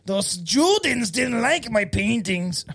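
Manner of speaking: put-on slow, heavy German accent; bad German accent, drawn out. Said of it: "I gotta get a better German accent".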